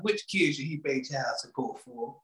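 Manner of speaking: lightly voiced judge, low and soft; a hint of a judge picking the kid; mock official. Podcast host mocking the new law, low mock judge voice.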